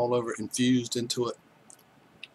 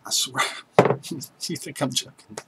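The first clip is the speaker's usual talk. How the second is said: usual speaking voice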